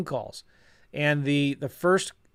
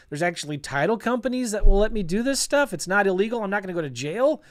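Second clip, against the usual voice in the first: rising excitement; 6 semitones higher than usual. Real, not acted. Investor telling viewers wholesaling works, building excitement. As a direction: let the thrill build line by line.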